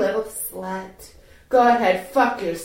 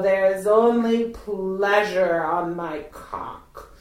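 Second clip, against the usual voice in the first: ordinary talk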